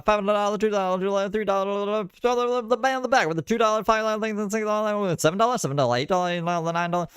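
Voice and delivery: high, fast auctioneer patter; mock auctioneer chant, rapid and high; auctioneer impression. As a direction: rattle off the bids like an auctioneer calling prices. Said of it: "those people who do, what is it, auctions?".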